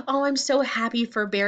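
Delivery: plain reading voice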